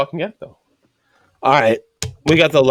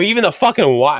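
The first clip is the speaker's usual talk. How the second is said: raised-pitch exasperated warning; mock telling-off of a rookie, pitched up; retold scolding; 6 semitones higher, 3 dB louder than usual.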